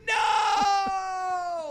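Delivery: very high, long-held yell; horrified mock scream. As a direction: wail it out as the plan collapses. Retold horror at Blade's scream.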